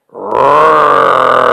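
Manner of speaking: low growling bear roar. Acted. To Bobby Bryna mid-hug, a low bear roar. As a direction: roar like a big friendly bear. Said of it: "The bear said".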